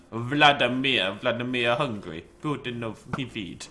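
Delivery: slow, clumsy mock Russian accent; bad Russian accent. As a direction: attempt a Russian voice for a hungry pet. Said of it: "I cannot do a Russian accent".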